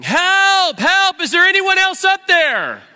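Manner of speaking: very high, full-volume comic scream; yelling for help. Acted. Jack refusing to let go, screaming desperately for help. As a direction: holler up past Jesus for anyone else. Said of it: "the next words out of Jack's mouth".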